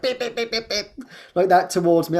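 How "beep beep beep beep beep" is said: high, quick beeps like a car horn; mimicked car horn. Imitating the driver behind honking at her. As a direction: tap out a horn's impatient beeps. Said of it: "the guy behind was like".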